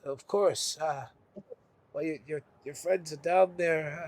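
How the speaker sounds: soft, low, hesitant advice; quiet, halting send-off; subdued Darren figure. Acted. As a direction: trail along quietly with pauses. Darren mumbles advice to the adventurer.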